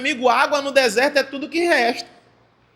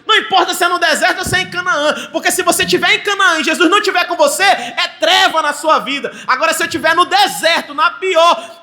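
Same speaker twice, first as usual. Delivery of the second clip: loud, high, driving preaching; pregação inflamada; 6 semitones higher, 6 dB louder than usual. Real, not acted. Preaches at full force. (pregação a plenos pulmões)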